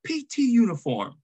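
high, strained mock command over the intercom; imitated drill sergeant. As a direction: bark the order down like a sergeant on the intercom. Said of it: "He said".

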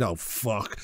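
low, hushed curse; understated, dropping oh-no moment; retold sinking dread. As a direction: mutter it low as dread sets in. Retold dread as cars pull up.

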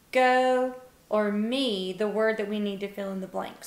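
ordinary talk, nothing marked

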